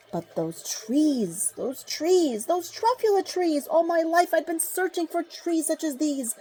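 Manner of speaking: from slow and hushed up to high and bright; building rapture. Acted. Onceler recalling the trees, building from slow hush to rapture. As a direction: climb from a whisper to delight.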